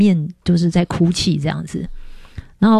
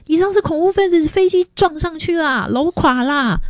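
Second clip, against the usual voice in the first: much higher, breathless alarmed report; alarmed telling; 10 semitones higher than usual. Retold or imitated. Family pointing at the TV, high alarmed outburst. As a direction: blurt out the terrible news in a rush of alarm. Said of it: "他们就打开电视跟我说".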